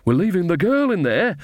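high-pitched, mild shout. A narrator's reading. Old witch protests while running away, mildly shouting. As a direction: call it out high, without full volume. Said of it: "shouted Nanny as they ran".

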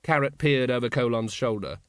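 ordinary delivery

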